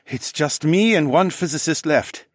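high-pitched, anxious man's voice; wary French-accented engineer. A narrator's reading. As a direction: plead that there is nothing to take.

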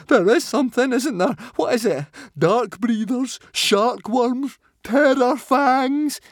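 very high, loud, panicky squeal; mounting panic. A narrator's reading. Swimmer guessing sea monsters, squealing in panic. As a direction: spiral into panic, loudest on last guess. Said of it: "beginning to panic".

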